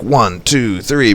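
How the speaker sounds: one, two, three rattled off quickly; fast counting. Shown as a demonstration. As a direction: rush through the count. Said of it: "if you go really fast, like".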